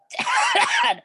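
hacking smoky cough; harsh cough. A narrator's reading. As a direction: cough hard as if choking on smoke. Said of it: "He choked".

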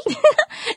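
a bright, high burst of laughter; amused laugh. Acted. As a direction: laugh at the silly question. Amused at "the baby?.

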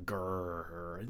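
low growling game monster noise; imitated growl. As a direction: growl like a Pac-Man ghost. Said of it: "the one that goes".

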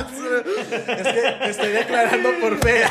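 laughing through the words; giggling, trying to hold it in; stifled giggles. Real, not acted. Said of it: "no te rías tan fuerte".

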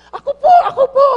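put-on high, fast, eager little kid voice; a child bursting to answer, laughing; excited child calling out with a laugh. Eager child raising hand.

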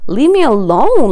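loud, high, drawn-out protest; angry outburst. Acted. Angry outburst at the man who approached.

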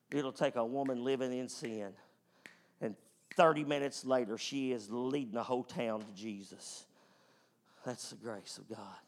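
ordinary delivery

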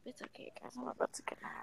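soft unvoiced whisper. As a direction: breathe it out as you slip away. Whispering as she leaves.

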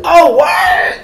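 high, loud shocked squeal; outraged disbelief, yelled high; shocked what. Retold or imitated. Joining the girl imitation.

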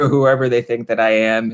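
normal talking voice

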